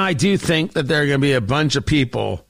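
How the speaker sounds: ordinary talk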